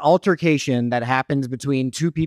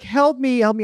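mock plea; 9 semitones higher than usual. Imitating man's fake plea.

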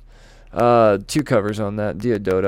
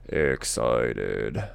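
deep mock excited; 12 semitones lower, 5 dB quieter than usual. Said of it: "I'm excited".

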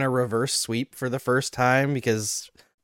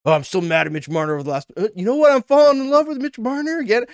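flip-flopping fan; 8 semitones higher, faster than usual. Retold or imitated. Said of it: "next season".

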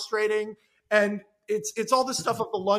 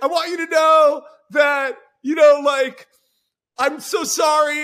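loud apology; 6 semitones higher, 10 dB louder than usual. Acted. David calling his ex.

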